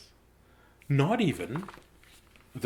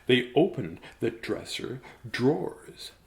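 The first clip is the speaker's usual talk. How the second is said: usual speaking voice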